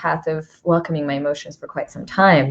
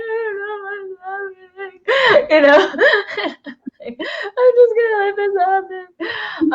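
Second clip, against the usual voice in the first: much higher, teary half-laughing whine; a hammed-up cry while laughing at herself; mock teary crying; 13 semitones higher than usual. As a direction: play up a weepy breakdown with laughter. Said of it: "I'm just like".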